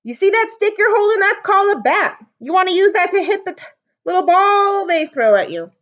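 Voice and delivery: high, loud mocking call; loud high playful taunt; teasing yell. A narrator's reading. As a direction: rib your friend from the stands. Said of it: "He looked down at Sam and yelled".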